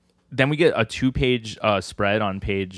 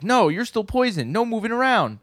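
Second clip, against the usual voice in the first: very high put-on scolding voice; high-pitched mock telling-off; 13 semitones higher than usual. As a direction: imitate someone firmly forbidding it, pitched way up. Acted scolding of poisoned man.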